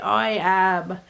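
usual speaking voice

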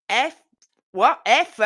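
loud, high bursts of the F word; mock shouted cursing. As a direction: bark the censored curses. Family swearing at home.

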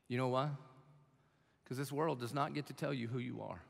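ordinary talk, nothing marked

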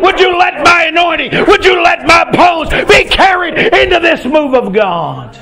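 high, driving revival sermon cadence; impassioned Pentecostal-style preacher; full-force preaching. Acted. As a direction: cry out the plea in rhythmic, sustained bursts.

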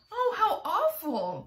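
slow shocked cry with a laugh; cried out. Acted. James reacts to the spider's story, shocked. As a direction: gasp in mock horror. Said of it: "James cried".

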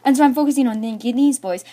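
ordinary delivery

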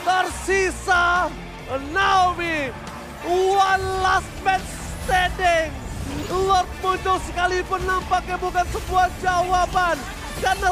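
fighting game announcer. Acted. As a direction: call the fight at full hype. Hypes a teamfight live.

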